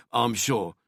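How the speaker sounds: plain reading voice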